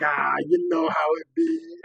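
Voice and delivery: loud, high, drawn-out crowing; over-the-top mock swagger, slow and loud; hammed-up retold boast. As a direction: stretch it out high and showy, imitating him.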